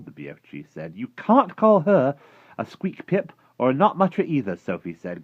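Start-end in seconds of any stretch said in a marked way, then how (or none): 1.0-4.6 put-on high, loud indignant girl; heated, high-pitched protest; growing anger. A narrator's reading. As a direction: object with mounting outrage. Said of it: "getting angrier and angrier".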